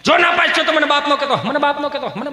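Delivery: mock fatherly outburst; stern father. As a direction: lay down an ultimatum to a son. Acts father threatening son.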